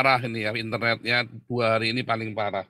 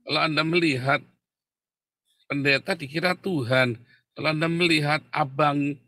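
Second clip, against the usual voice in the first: raised preaching voice, emphatic; 4 semitones higher than usual. Acted. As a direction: preach it to the congregation. Preaching sermon online.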